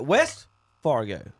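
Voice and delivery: "West" drawn out slowly before Fargo; "West" set apart and emphasized; stress on West. Real, not acted. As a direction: lean on "West" to correct yourself. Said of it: "not regular Fargo".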